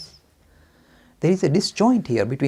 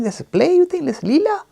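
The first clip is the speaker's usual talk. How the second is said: high, louder, disbelieving challenge; incredulous objector; 5 dB louder than usual. Acted. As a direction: question it in indignant disbelief.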